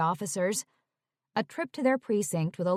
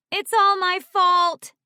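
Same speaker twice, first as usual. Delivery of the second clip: high, loud wail of guilt; best friend wailing her apology; 11 semitones higher, 8 dB louder, slower than usual. Acted. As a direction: throw the line out in a high wail. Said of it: "Tori wailed".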